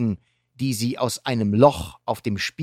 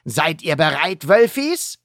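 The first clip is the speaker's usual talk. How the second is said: rallying call; 5 semitones higher, 4 dB louder than usual. Acted. Rallying the pack.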